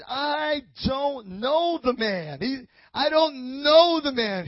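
loud, high, drawn-out protest; emphatic denial. Acted. Preacher as Peter denying Jesus with an oath, emphatic.

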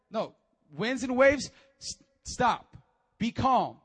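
Jesus commanding, preached with force; stern rebuke. Acted. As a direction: order the storm to stop. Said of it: "He rebuked the storm".

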